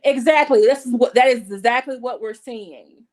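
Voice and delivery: loud, emphatic agreement. Real, not acted. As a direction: hit it hard, fully agreeing.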